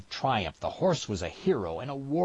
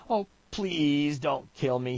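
slow, higher, drawn-out plea; begging; 6 semitones higher than usual. A narrator's reading. Trapper with gun at forehead, desperate begging. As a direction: stretch the please in desperation. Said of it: "he begged".

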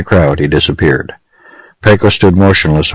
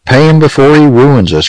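high-pitched, urgent plea; urgent cry; 8 semitones higher than usual. A narrator's reading. Terrell begs Pecos to pay the judge, crying out. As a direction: cry out to stop a disaster. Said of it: "cried Terrell".

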